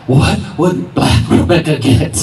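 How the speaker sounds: slow, spaced-out self-questioning; mock-solemn inner question. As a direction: ponder it slowly, tongue in cheek.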